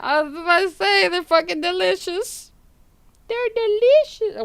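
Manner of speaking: high, loud, giddy voice; amused squeal. Real, not acted. Amused, joking along with chat.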